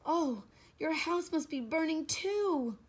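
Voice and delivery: high, loud cry of dismay; drawn-out, high, distressed child's voice; dismayed lament. Acted. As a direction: wail the awful news. Bill laments burning house.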